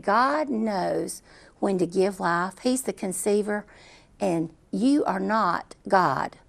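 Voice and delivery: very slow, every word weighted; mother rebuking the doctor, slow and deliberate; slow solemn rebuke. Retold or imitated. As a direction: lay it down word by word, unshakable. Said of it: "I said".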